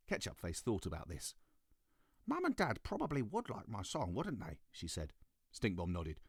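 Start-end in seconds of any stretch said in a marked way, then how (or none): 2.3-4.6 put-on high, hopeful child; a small girl wondering aloud; little girl voice. Ketchup Face voiced.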